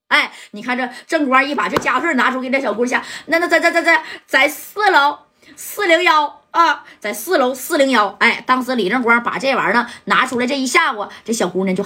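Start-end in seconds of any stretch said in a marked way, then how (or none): 3.3-8.2 frightened stammer. Acted. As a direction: stumble over every word, terrified. Waitress stammers under threat.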